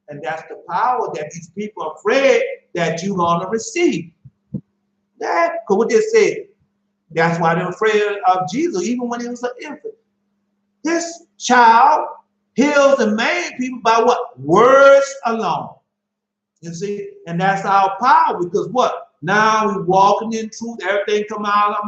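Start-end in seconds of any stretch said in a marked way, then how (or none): none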